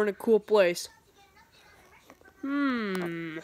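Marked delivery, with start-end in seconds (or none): none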